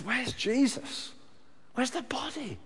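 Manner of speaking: slow, high, falling-away plea; anguished plea. Acted. Mary torn apart, pleading slowly for the body. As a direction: ask through heartbreak.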